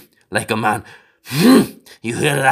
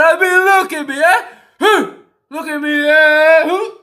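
mock tirade building from loud to a much higher shout; hammed-up furious shouting, climbing higher; mock rant; 10 semitones higher, 6 dB louder than usual. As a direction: rant yourself hoarse at her, half joking.

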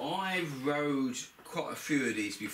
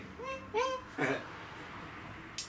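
high airy laugh; 5 dB quieter than usual. Real, not acted. Laughing at the bloody exhaust.

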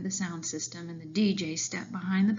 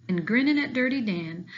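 plain reading voice

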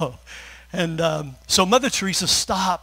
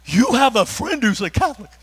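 high, loud mock gasp of disbelief; mock shocked; 7 semitones higher, 7 dB louder than usual. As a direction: play the scandalised listener. Mock shocked congregation reaction.

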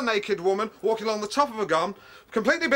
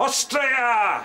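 exasperated correction. Acted. Correcting film details in exasperation.

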